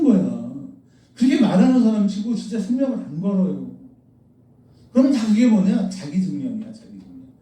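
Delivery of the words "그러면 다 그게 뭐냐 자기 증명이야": ordinary talk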